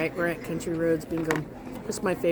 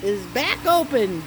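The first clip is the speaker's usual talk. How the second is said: high, loud and bright, then slowing down; excited announcement; 11 semitones higher, 8 dB louder, slower than usual. Real, not acted. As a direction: announce big happy news with a beaming voice. Cheerful announcement of pool reopening.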